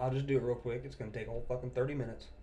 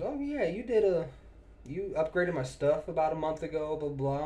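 higher-pitched imitation of a caller; imitated customer; 5 semitones higher, 3 dB louder than usual. Customer reminds technician of upgrade, imitated in a higher voice. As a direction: lightly mimic a customer rattling on, pitched up. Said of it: "She goes".

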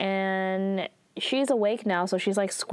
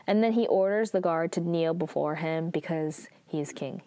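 ordinary talk

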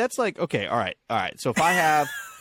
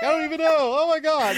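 much higher, louder ditzy panic; flustered infomercial woman; 4 dB louder than usual. Retold or imitated. Clumsy infomercial woman squealing at her own mess. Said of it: "She's like".

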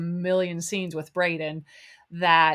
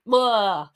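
loud grossed-out blah; disgusted blah; 2 semitones higher, 6 dB louder than usual. Real, not acted. Disgust noise about frogs.

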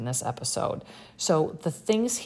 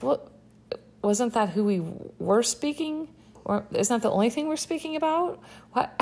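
incredulous; 6 semitones higher than usual. Retold or imitated. Incredulous reaction to friends' line.

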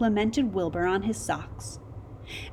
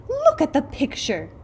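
higher, insistent demand; 7 semitones higher, 4 dB louder than usual. A narrator's reading. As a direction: demand that he look. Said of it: "they demanded".

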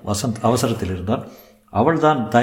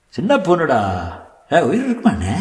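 lightly voiced bystander, pitched higher; curious onlooker; 5 semitones higher than usual. Bystander asking another whether the girl is still alive, curious. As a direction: ask it as a gawking onlooker, a little raised.

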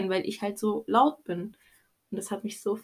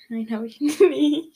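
laughing admission; 6 semitones higher, 6 dB louder, slower than usual. Real, not acted. Admits never listening, laughing.

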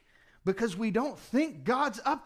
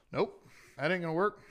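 quick, offhand brush-off; dismissive disciple; 6 semitones lower, faster than usual. Retold or imitated. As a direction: toss the idea away like a doubtful disciple. Disciples counting beans.

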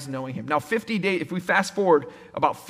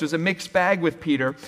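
ordinary talk, nothing marked

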